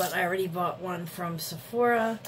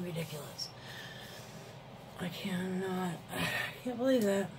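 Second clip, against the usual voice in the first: soft, lower, disbelieving aside to oneself; hushed, real exasperation; dismayed muttering; 3 semitones lower, 10 dB quieter than usual. Real, not acted. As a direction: mutter it in stunned frustration. Still upset, talking to self.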